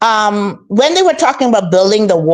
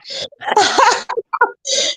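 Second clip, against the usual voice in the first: high, breathy peal of laughter; 13 semitones higher, 4 dB quieter than usual. Real, not acted. Guest still laughing at the question about the Lord. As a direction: laugh it off again.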